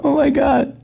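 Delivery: high gasping oh my God; mock shock. Host mocking news with a high fake gasp. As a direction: squeal it in fake horror.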